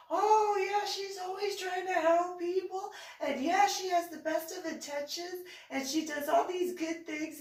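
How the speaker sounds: put-on eager children. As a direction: gush like excited kids defending him. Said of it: "both my children at that point".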